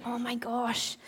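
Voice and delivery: breath-only whisper, acting aghast; hushed dismay. Friends telling Nehemiah of the ruins, whispered dismay. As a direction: whisper it in mock shock.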